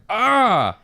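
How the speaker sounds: short loud startled ah; blinded yelp. Retold or imitated. Blinded by a cop's light, startled yelp. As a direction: yelp at the glare. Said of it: "I'm like".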